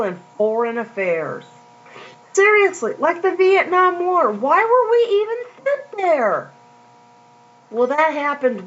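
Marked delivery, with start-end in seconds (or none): 2.3-6.5 high-pitched, exasperated question; incredulous protest. Real, not acted. Preacher questioning the Vietnam War, high incredulous protest. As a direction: push the pitch way up in disbelief.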